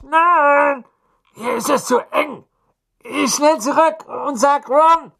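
high panicked cries, yelping in pain; panicked boy voice. A narrator's reading. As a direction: yelp and shout from a cramped chimney. Fred stuck in blocked chimney.

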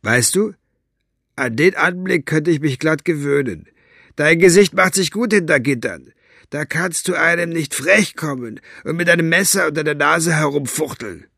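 a touch of smug mockery; lightly voiced gloating henchman. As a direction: gloat at the caged man. Flachnase gloats at caged Basta.

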